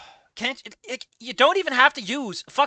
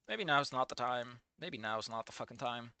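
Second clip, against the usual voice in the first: resigned mutter; 10 semitones lower, 16 dB quieter than usual. Real, not acted.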